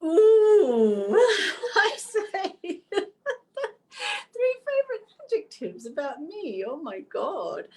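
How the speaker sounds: high squealing laugh, caught off guard; flustered laughing. Real, not acted. Interviewer asks for her adjectives, she squeals with flustered laughter. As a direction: laugh it off, embarrassed and delighted. Flustered by question about herself.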